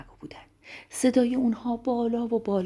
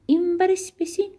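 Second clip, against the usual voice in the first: high-pitched seesaw call; playful, high storybook voice; 10 semitones higher than usual. Acted. Acting out a seesaw game.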